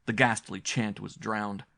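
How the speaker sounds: ordinary delivery, nothing marked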